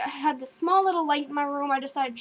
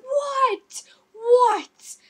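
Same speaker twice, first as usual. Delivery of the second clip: high, loud, startled questioning; acting out a brother's alarmed yelps; 9 semitones higher, 6 dB louder than usual. Brother answering the sudden scream, startled.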